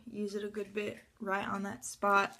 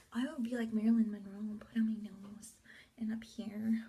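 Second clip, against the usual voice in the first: whisper. Real, not acted. Whispered aside about Marilyn.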